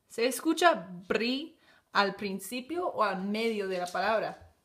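ordinary talk, nothing marked

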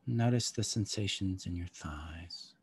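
calm guide easing them inward; guided meditation. Acted.

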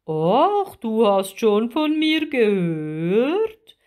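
flattered giant octopus, slow and swooping; big drawn-out creature voice, rising into the question. A narrator's reading. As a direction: preen like a pleased sea giant. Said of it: "fragte der Krake geschmeichelt".

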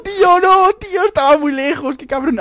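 loud, high wail of protest; real cry of frustration; anguished shouting. Real, not acted. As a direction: scream out in dismay, then ease slightly. Scream after losing the kill.

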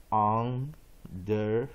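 slow, drawn-out underwater; slow demo. Shown as a demonstration. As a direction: model it slowly for students. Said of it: "所以再一遍".

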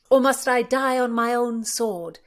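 loud, high mock-heroic lament; hammed-up cry before a pretend death; mock-tragic. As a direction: declaim it as a playful tragic hero. Mock death with imaginary sword.

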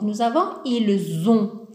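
ordinary talk

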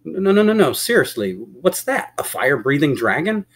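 startled disbelief. Acted. Yvonne sees the beast running from the castle, startled. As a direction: blurt it out, then demand an explanation.

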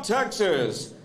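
ordinary talk

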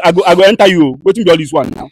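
ordinary talk, nothing marked

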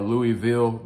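Louisville as LOO-ee-ville, silent S; accepted alternative pronunciation. Shown as a demonstration. Offering the other accepted hometown name, silent-S pronunciation. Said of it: "that's what we will accept".

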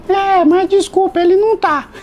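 super thin voice. Retold or imitated. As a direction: disguise your voice way up high. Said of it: "uma voz super fina".

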